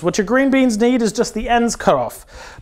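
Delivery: ordinary delivery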